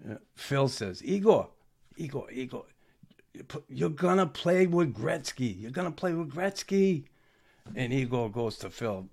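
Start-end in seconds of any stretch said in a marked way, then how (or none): 1.0-7.1 higher-pitched, pleading coax to a reluctant rookie; coaxing plea. Retold or imitated. GM pleading with Igor to accept the trade, eager coaxing. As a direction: sell him on it, eagerly.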